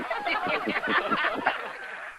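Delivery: breathy high-pitched laugh at the prank; high airy laugh. Real, not acted. Host laughing after revealing the prank, high airy giggle. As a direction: let out a high unvoiced giggle.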